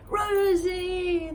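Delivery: high excited cheer; a crowd cheering her name. Acted. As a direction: call her name with delight. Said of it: "they cheered".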